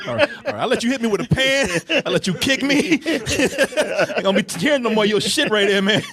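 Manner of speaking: laughing. Real, not acted. Laughing at the clip.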